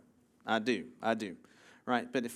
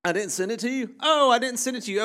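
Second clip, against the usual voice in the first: higher-pitched, caught-off-guard realization; real surprise; 8 semitones higher, 4 dB louder, faster than usual. Real, not acted. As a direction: realize out loud that you slipped up. Finds the promised clip was never sent.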